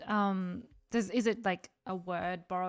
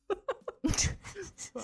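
laughing at herself; 12 semitones higher than usual. Real, not acted.

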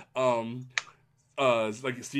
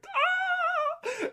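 very high drawn-out mock wail; hammed-up high cry of being overwhelmed. Acts out own tearful reaction.

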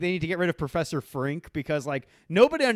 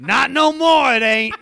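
higher and much louder cartoon snarl; mock gruff yell; 7 semitones higher, 11 dB louder, slower than usual. Bartender throwing out the record, hammed-up loud yell. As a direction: bark it like a cranky bartender. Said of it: "And he goes".

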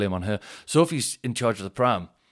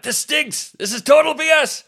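high, loud mock outraged complainer; Bob Bulldog Briscoe; 8 dB louder than usual. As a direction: blow up like a cartoon grump. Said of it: "I'm Bob Bulldog Briscoe".

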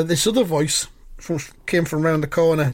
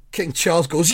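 ordinary talk

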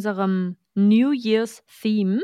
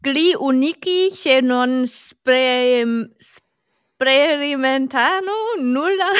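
slow, higher, hammed-up Italian; Italian reading; 5 semitones higher, 4 dB louder, much slower than usual. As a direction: read it out like a proud Italian. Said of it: "Auf welcher Sprache soll ich's denn vorlesen?".

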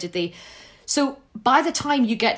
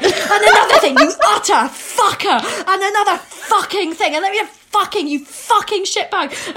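high, laughing imitation of a tirade; mock ranting; 9 semitones higher, 5 dB louder than usual. Retelling her friend's dad's debate rant, mock ranting. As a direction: act out someone venting, amused by it. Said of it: "letting it all out".

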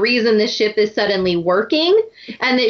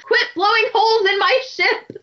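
high, indignant pirate complaint; high mock pirate outrage; angry pirate captain; 10 semitones higher than usual. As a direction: scold the cannons like Jack Sparrow. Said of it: "perfect. Flawless".

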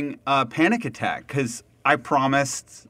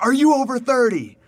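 boisterous Dutchman, high-pitched bad Dutch accent; badly faked Dutch accent, pitched up; attempted Dutch accent; 11 semitones higher, 4 dB louder than usual. Boisterous Dutch man accosts a tourist, attempted Dutch accent. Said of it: "I won't do the Dutch accent".

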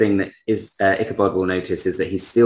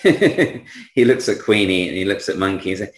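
ordinary talk, nothing marked

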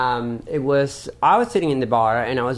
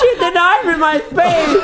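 high, loud, laughing through the words; laughing shout; 13 semitones higher, 5 dB louder than usual. Retold or imitated. The fan complains to Paul, laughing and high. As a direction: shout it while cracking up.

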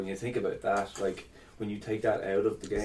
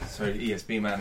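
normal talking voice

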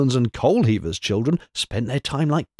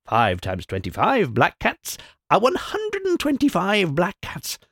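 much higher, slow crone chant; slow gloating incantation; 8 semitones higher than usual. A narrator's reading. Wise woman multiplying cats to scare visitors, gloating chant. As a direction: relish the spell like a gleeful witch. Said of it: "added the wise woman with a chuckle".

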